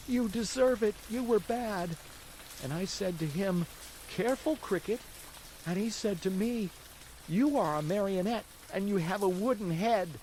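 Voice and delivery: put-on high puppet boy voice, pitched well up; a hungry wooden boy telling his woes; high, childlike marionette recounting his night; Pinocchio's voice. Pinocchio tells his story.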